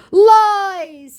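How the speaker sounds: loud, very high, stretched cry; mock declaration. Scammer denies her travels, hammed-up declaring. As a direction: proclaim it in hammed-up outrage. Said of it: "he declares with a laughing emoji".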